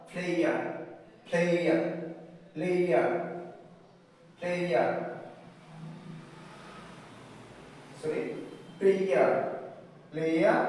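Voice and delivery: player ending in a smooth eɪə glide; example word drill. Shown as a demonstration. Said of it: "As in the words". (উদাহরণ শব্দ player টেনে)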